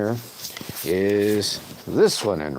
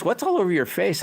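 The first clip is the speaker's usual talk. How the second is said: a hint of a surprised wife; mildly mock the wife asking; lightly voiced wife; imitating wife; 11 semitones higher than usual. Wife voiced in retold story.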